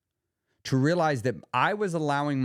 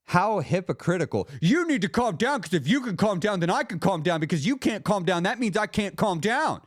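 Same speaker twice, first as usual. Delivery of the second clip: mock hypocritical rant; 6 semitones higher than usual. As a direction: rant like a hypocrite blaming the other person. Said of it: "and I was like".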